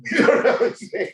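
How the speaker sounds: words through a high laugh. Real, not acted. Laughing at news comedy.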